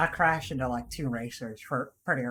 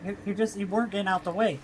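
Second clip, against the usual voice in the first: usual speaking voice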